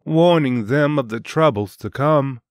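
ordinary delivery, nothing marked

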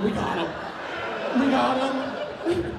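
gleeful. Retold or imitated. Mom's triumph.

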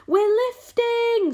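very high, long, loud cry; shout. A narrator's reading. Somebody feeling the peach lift, shouting. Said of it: "somebody shouted".

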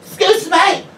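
high, loud demand for attention; demanding customer. Retold or imitated. Drunk woman demanding the shop worker's attention, loud and high.

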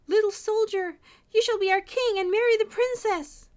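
very high, loud acclaim; shouting crowd. A narrator's reading. People proclaiming the soldier their king, shouting. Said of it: "people began to shout".

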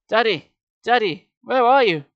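loud, high calls for a missing father; frantic cries. A narrator's reading. Sarah calls for her father in the pyramid, frantic. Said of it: "Sarah's cries became more frantic".

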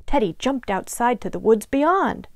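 ordinary delivery

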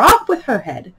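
high, loud royal yell; shouting. A narrator's reading. Queen sentencing the players, shouting. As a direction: shriek the sentence like an imperious Queen. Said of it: "quarrelling with the other players and shouting".

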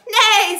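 high, very loud neigh; whinny out loud; horse neigh. A narrator's reading. Horse hears of the plot, loud high neighing. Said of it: "said the horse".

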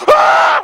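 high loud ah; loud shout. Retold or imitated. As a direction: yell it with the mouth wide. Wide open mouth.

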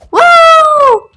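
high, sustained "woo"; gleeful whoop. Real, not acted. Player dancing in the dancing chamber, gleeful whoop. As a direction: let out a long party cheer. Cheering in the dance area.

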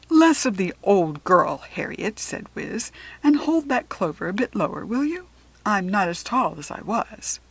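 put-on loud, emphatic creature rebuking a child; lofty animal putting a girl in her place; forceful, mock-indignant animal voice; Wiz, indignant talking animal. Voices Wiz, hare turned cow, rebuking.

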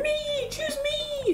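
very high, small pleading voice; eager villager. Acted. Villager begging to be picked, high and eager.

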